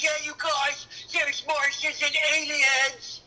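put-on high, slow excited kid; wide-eyed boy telling a secret; Harvey voice. Shown as a demonstration. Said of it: "a guy named Harvey".

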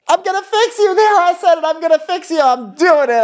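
squeaky mock voice way up high, proudly announcing; mock savior voice. Mocking the boyfriend's girlfriend, cartoonish high-pitched fixer voice. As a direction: play the smug rescuer in a piping falsetto.

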